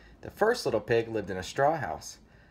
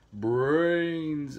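higher, drawn-out zombie call; zombie moan; 5 semitones higher, 3 dB louder than usual. A narrator's reading. Zombie interrupting the pigs, long moaning call. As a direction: cut in with a long hungry moan.

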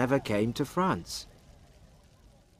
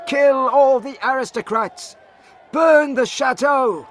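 very high, loud battle cry; full-voiced acted rabble-rousing; angry mob shout; 8 dB louder than usual. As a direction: yell it like a furious crowd at the gates. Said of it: "they shouted".

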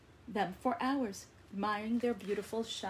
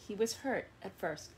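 usual speaking voice

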